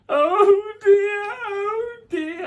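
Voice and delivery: very high, loud wailing howl; howling wolf. Acted. Wolf howling with a rumbling belly, high and loud. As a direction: howl it out in pain. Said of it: "as the wolf howled".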